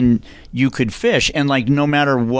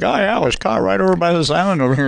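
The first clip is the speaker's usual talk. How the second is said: boastful fisherman; 6 semitones higher, faster than usual. Retold or imitated. Voicing fishermen bragging.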